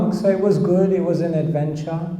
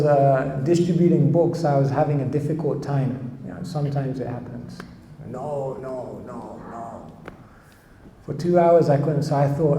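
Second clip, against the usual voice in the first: usual speaking voice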